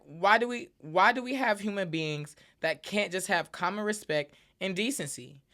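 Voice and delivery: usual speaking voice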